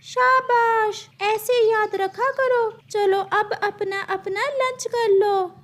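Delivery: high, bright-pitched teacher bird voice; a high squawky parrot teacher calling on a student. Acted.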